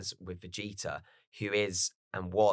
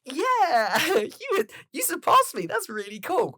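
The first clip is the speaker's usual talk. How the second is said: very high, cheerful Goku impression; goofy Goku; 5 dB louder, slower than usual. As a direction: gush like a happy kid. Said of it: "Goku's like".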